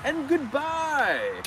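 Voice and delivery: high, very slow singsong hello and goodbye; high, slow, regal singsong; queen-like wave greeting. Pretend. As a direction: greet traffic like royalty. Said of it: "wave like the queen to traffic".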